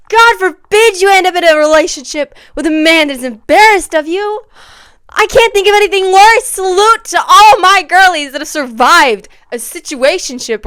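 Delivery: loud, high exclaiming; impassioned rant. Real, not acted. Vlogger warning girlies off men embarrassed of them, impassioned rant. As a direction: declaim it with full outrage.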